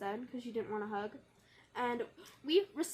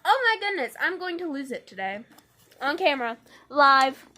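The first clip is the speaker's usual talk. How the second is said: loud, exasperated outburst; on the verge of losing it; 10 dB louder than usual. Real, not acted. Losing composure on camera.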